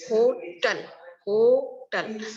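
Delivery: होटल broken into two slow beats; split into syllables. Shown as a demonstration. Teacher showing students two syllables, होटल split slowly in two. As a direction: say होटल as "ho... tal", a gap between. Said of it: "ये two syllable में divide करते हैं".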